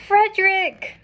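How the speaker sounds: high, stretched cry of recognition; excited storybook cry; joyful cry. A narrator's reading. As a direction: call out to a lost friend just found. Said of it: "cried Jonathan".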